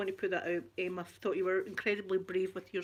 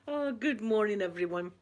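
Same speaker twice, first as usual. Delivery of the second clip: usual speaking voice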